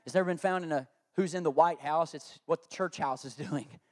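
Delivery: ordinary delivery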